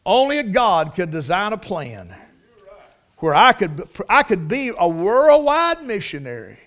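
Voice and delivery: ordinary talk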